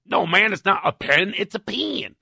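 exaggerated Texas accent on "pen". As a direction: insist in an imitated Texas twang. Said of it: "And he laughs. He goes".

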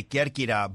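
Kerkyra said the Greek way, "KER-kee-da" with a tapped r; Greek pronunciation. Shown as a demonstration. Guest showing host how Greeks say it, Greek pronunciation of Kerkyra. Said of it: "the Greeks say".

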